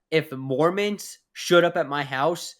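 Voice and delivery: ordinary talk, nothing marked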